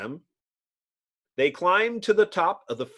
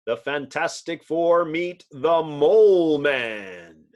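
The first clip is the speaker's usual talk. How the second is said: loud, slow announcer title read; radio serial announcer; title announcement; 5 dB louder, slower than usual. A narrator's reading. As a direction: proclaim the episode title.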